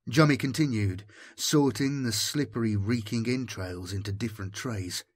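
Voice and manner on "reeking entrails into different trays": ordinary delivery, nothing marked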